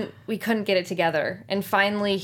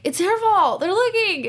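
high, louder mock kid whine; kids blaming each other; 12 semitones higher, 5 dB louder than usual. Actress replays cast blaming each other, mock childish. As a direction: point the finger like a child at school. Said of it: "we were like".

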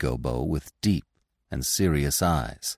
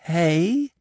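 amazed; 13 semitones higher than usual. Acted. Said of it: "they all cried at once, amazed".

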